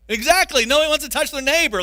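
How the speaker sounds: loud, high preaching burst; preaching at full force. Real, not acted. Preacher rousing the congregation, loud and high. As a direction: drive it home to the congregation.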